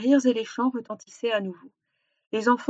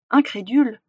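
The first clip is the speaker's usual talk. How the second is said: ordinary delivery, nothing marked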